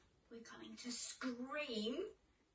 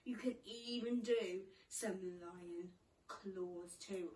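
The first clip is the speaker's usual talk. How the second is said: ordinary talk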